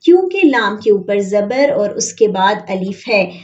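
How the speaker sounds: ordinary delivery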